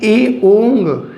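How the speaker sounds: एओंग slowly, open ए then ओ; एओंग spelled out. Shown as a demonstration. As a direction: say एओंग as ए plus ओ, drawn out. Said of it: "आप एप्पल वाला ए कह सकते हैं, इसे ओ कह सकते हैं". (एओंग को ए और ओ मिलाकर धीरे बोलें)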